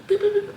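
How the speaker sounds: high-pitched little beeps; imitated warning beep. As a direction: mimic a flashing warning light's beep. Imitates red lights flashing.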